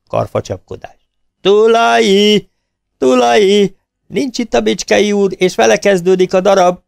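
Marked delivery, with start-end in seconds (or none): 1.4-6.8 high, loud furious bellow; howling shout. A narrator's reading. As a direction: roar for the curtain and for Tulai. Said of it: "Üvöltés".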